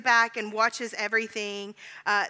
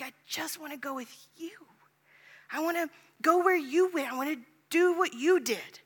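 higher, yearning cry of the soul; heartfelt plea; 6 semitones higher than usual. Acted. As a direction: plead it from the heart.

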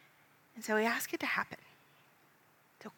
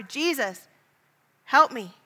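higher, louder desperate call to Jesus; louder and louder cry; 5 semitones higher, 9 dB louder, slower than usual. Acted. Beggar calling out to Jesus over the crowd, growing louder. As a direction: build the plea into a yell. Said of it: "You get louder and louder".

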